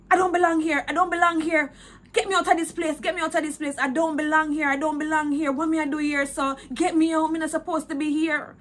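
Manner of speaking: high, loud frightened plea; terrified pleading. Retold or imitated. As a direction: beg in panic to get out. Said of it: "and I was so scared".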